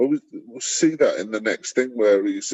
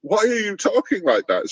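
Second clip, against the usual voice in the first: mock indignant official; 8 semitones higher than usual. Mocking the official's rebuke.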